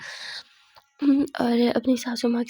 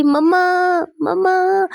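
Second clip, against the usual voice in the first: acted loud, raised call; calling for Mumma; 6 semitones higher, 7 dB louder, slower than usual. Daughter-in-law pinned down, calls out for help, playful yell. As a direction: call out to Mumma, drawing it higher.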